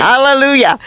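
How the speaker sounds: retelling a friend's glad outburst; joyful hallelujah. Retold or imitated. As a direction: exclaim it like good news just landed. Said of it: "So that's what Bill, um, Tom said".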